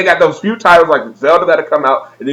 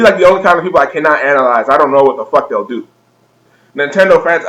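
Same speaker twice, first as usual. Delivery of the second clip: usual speaking voice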